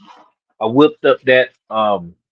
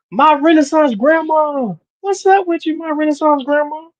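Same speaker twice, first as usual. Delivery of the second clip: high sing-song greeting. Real, not acted.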